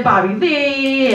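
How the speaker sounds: ordinary delivery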